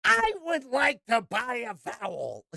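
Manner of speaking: high, slow hammed-up imitation; Godfried. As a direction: overdo it as a comically drawn-out proclamation. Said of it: "to Godfried".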